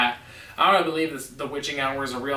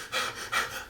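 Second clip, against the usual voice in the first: panicked gasp; 11 dB quieter than usual. Retold or imitated. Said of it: "I was like".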